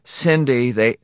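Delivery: high, loud, desperate call; miserable call. A narrator's reading. Husband calling through glass to his wife, miserably. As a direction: try miserably to reach her. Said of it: "Morrison called miserably".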